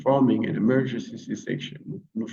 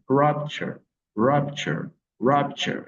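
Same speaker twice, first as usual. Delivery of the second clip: "rupture" with RUP pitched higher; stress on first syllable; 5 semitones higher, slower than usual. Shown as a demonstration. Teacher modelling for learners, "rupture" stressed on RUP. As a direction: punch RUP in "rupture", let the ending fall away. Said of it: "L'accent tonique est placé sur la première syllabe".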